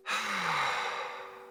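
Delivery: long, breathy sigh of released tension; releasing sigh. Shown as a demonstration. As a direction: let the tension out in one long exhale. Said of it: "what do we do naturally".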